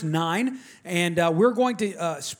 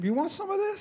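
high, sneering taunt, softer; tough-guy attitude; 12 semitones higher, 5 dB quieter than usual. Shown as a demonstration. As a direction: dare them with a sneer. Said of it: "That's, that's how, yeah, it's like".

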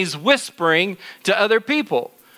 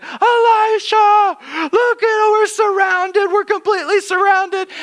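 panicked servant; 13 semitones higher, 4 dB louder than usual. Acted. Servant sees the army surrounding.

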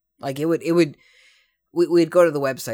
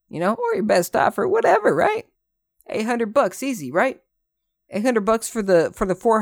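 mocking scalper voice; 8 semitones higher, 4 dB louder than usual.

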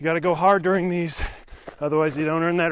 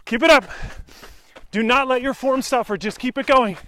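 coaching shout; 6 semitones higher than usual. Real, not acted. Coaching runner during interval.

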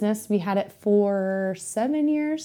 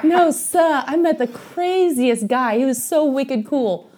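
put-on light Boston accent, higher and slowed, quoting a friend; 6 semitones higher, slower than usual. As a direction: do her Boston drawl on wicked cool, a hint only. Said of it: "You gotta do her accent".

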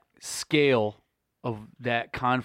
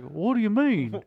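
puzzled dad; 7 semitones higher than usual. Retold or imitated.

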